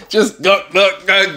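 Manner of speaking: ordinary delivery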